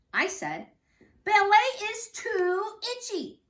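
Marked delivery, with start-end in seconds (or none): 1.2-3.4 high, slow, loud complaint; child loudly complaining in class; a bit loud. Acted. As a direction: whine about the itchy ballet clothes. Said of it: "But I was a bit loud".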